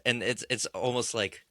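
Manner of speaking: usual speaking voice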